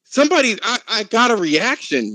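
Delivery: gasp, then high and breathless with fake delight; lightly mocking victim voice; mock attention-seeking victim. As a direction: ham up a thrilled victim starved for notice. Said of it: "you're like".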